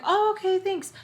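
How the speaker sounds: very high, put-on politeness; polite reply. Guest accepting food from hosts, high polite voice.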